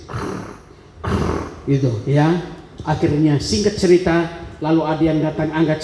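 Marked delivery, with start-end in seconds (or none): 0.1-1.7 unvoiced throat rasp "Krrr"; rasping breath. Man imitating breath escaping his neck, a soft unvoiced rasp. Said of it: "Bernapas keluar dari sini".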